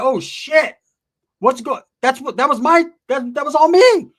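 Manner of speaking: panicked falsetto, mock horror; high shocked squeal. Comic catching himself: high shocked squeal. Realizes the noises were his.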